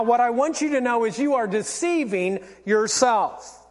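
ordinary delivery

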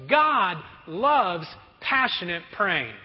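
ordinary delivery

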